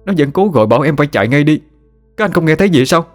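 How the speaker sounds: put-on a scared young man insisting on what he saw; frightened telling. Frightened youth recounting ghost vision.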